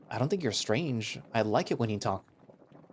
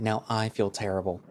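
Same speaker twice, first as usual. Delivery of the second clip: plain reading voice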